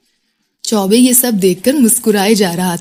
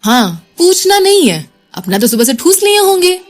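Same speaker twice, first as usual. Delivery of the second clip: higher, pouting sarcastic jab; sulky pout; 8 semitones higher than usual. A narrator's reading. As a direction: sulk and taunt him. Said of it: "वो मुंह बना के बोली". (मुंह बनाकर ताना मारना)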